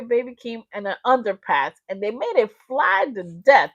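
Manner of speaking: usual speaking voice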